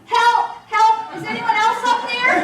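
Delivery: high, loud punchline yell with a laugh in it; desperate shout, barely holding in laughter; yelling for help. Retold or imitated. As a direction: shout it up the cliff, cracking up. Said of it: "we hear Jack yelling".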